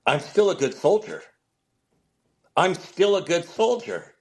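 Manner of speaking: retold choked-up declaration; imitated emotion. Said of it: "he's emotional, and he goes".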